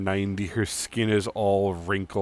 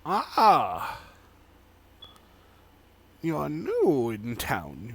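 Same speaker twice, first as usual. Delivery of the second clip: put-on 1 old woman; 5 semitones higher, slower than usual.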